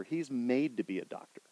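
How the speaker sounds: usual speaking voice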